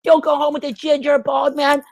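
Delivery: hammed-up high nagging friend voice; mocking friend. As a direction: squeal it like a prissy friend talking her out of it. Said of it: "her friend's like".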